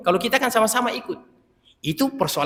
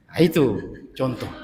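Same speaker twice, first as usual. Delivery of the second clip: ordinary talk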